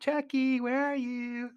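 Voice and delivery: high-pitched, put-on searching call; imitated kid calling out. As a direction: call out like a wandering child.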